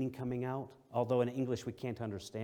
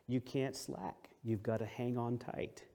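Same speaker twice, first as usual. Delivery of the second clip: ordinary talk, nothing marked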